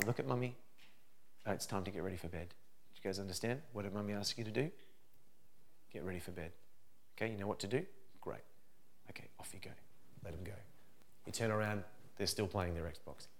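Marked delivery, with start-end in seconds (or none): 0.0-10.5 put-on soft, low, patient instruction to the children; calm mummy voice. Shown as a demonstration. Mum calmly tells the kids to get ready for bed, soft and patient. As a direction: speak gently so they listen. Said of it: "this is how you train".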